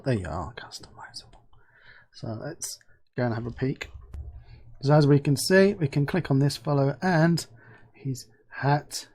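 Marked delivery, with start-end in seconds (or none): 0.0-3.9 "they are customizable" whispered conspiratorially; a playful whispered echo; whispered aside. Acted. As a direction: breathe it like a secret. Whispered aside after the remark.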